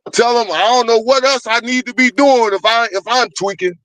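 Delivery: usual speaking voice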